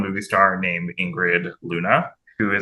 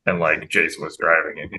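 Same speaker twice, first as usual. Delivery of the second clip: normal talking voice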